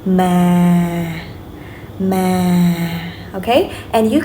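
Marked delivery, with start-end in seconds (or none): 0.1-3.1 "Ma" drawn out, slowly sinking; falling tone. Shown as a demonstration. Teacher repeats the third tone, falling slowly. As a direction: let each "Ma" drift down gently. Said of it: "your voice falls slowly".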